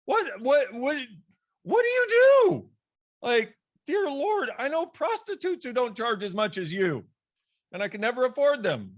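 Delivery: outraged squeal building at the price; incredulous outrage. Retold or imitated. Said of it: "an amount that I was like".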